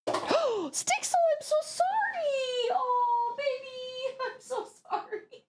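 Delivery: squealing, teary high-pitched sorry; high crying apology. Real, not acted. Owner wailing sorry to the dog she just hit, high and teary.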